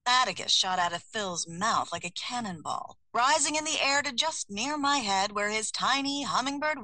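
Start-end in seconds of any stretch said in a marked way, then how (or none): none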